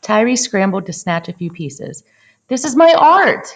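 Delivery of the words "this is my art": loud, much higher anguished cry. A narrator's reading. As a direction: cry it out as the art is smashed. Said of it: "he cried".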